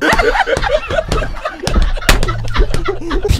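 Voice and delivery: shrill taunting laugh; high mocking cackle. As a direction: cackle at the son. Mom character laughs.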